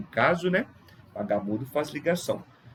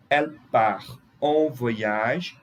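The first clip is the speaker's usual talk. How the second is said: usual speaking voice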